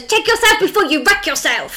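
high-pitched catchphrase impression; put-on character voice, pitched right up; Kenneth. Podcaster quoting a TV character to her co-host, high mimicking voice. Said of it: "And he goes".

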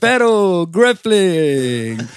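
slow, drawn-out ring announcer hype; Bruce Buffer. Acted. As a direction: stretch every word like a fight announcer. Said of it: "Bruce Buffer".

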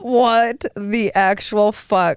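drawn-out what the actual fuck; slow appalled disbelief. Real, not acted. Friend hearing the minivan story, slow appalled disbelief. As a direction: stretch each word in disbelief.

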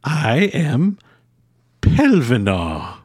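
grand hermit voice. Acted. Hermit names himself.

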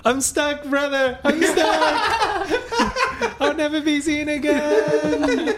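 high, loud laughing wail; too loud. Acted. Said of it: "Shh, keep your voice down".

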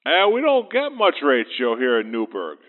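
usual speaking voice

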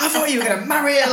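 high, fast indignant mum; annoyed mum. Retold or imitated. Mum scolding him over the prank, high fast indignation. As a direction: rattle it off, offended.

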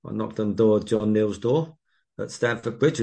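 ordinary talk

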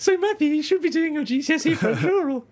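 very high put-on interviewer; mock interviewer. Mock interview about missing the exam, squeaky high voice. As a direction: squeak out the question as a silly interviewer.

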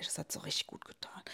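breathy whisper, soft; hushed, savoring whisper. Real, not acted. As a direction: whisper it with relish. Mother agrees about the workout.

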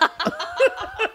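very high squealing laughter; high shriek laugh. Real, not acted. Laughing hard.

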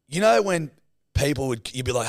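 ordinary talk

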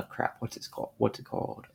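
quiet, searching for the forgotten word; muttering to self. Real, not acted. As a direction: ask yourself under your breath. Searching memory, talking to self.

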